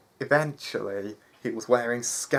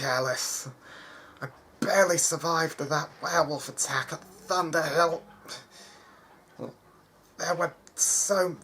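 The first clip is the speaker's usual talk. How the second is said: high, pained voice, gasping between words; a wounded boy forcing words out through pain; painful breaths; 7 semitones higher than usual. Acted. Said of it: "said through painful breaths".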